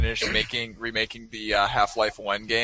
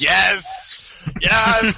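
cheering yes, loud and high; excited shout; 8 semitones higher, 6 dB louder than usual. Real, not acted. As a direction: shout it out in delight. Cheering a favourite quote.

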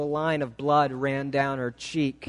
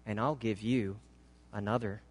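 softly spoken, low and unhurried; gentle, hushed promise; 7 semitones lower, 9 dB quieter, faster than usual. A narrator's reading. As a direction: offer it tenderly, very quietly. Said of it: "he said so gently".

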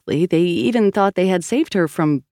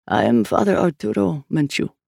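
priest Menchu. A narrator's reading. Priest introduces himself.

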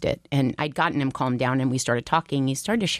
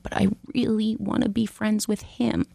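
put-on higher, earnest little-boy plea; a hint of a child pleading; lightly voiced pleading child; 6 semitones higher than usual. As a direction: plead a little, like a young son. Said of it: "He's like".

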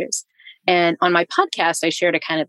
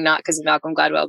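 ordinary talk

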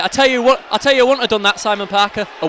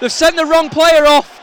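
outraged shout; 9 semitones higher, 8 dB louder than usual. Real, not acted. Echoing outrage.

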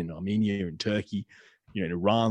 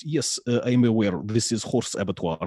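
put-on deadpan English-accented reply; dry, matter-of-fact English accent; 2 semitones higher than usual. Abattoir worker answering the journalist, English accent deadpan. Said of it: "frighteningly good, uh, English accent".

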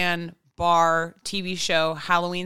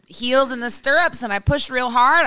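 higher, louder retold push; mock strained mom; 5 semitones higher, 5 dB louder than usual. Retelling mom describing the birth, higher and louder. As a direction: act out mom's big push with gusto. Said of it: "my mom was like".

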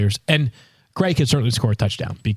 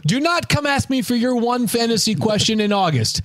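exasperated rant; 11 semitones higher than usual. Retold or imitated. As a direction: vent the annoyance, high and pushed. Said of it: "I'm like".

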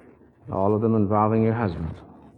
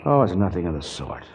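ordinary talk, nothing marked

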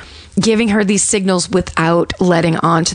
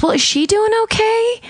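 much higher, sugary operator voice; sweet as pie. Retold or imitated. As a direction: soothe the caller sweetly. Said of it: "sweet as pie".

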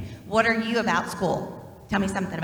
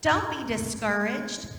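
slow, deliberate "Don't be discouraged."; scripture reading; 2 semitones higher, slower than usual. Acted. Pastor quoting the verse to children, slow and solemn. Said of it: "It says in Joshua one nine".

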